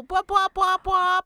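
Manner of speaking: high, loud bwop calls like a crow; crow call imitation. Shown as a demonstration. Host imitating crows for co-hosts, high bwop calls. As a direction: mimic a crow calling out. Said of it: "That's the sound of the crows".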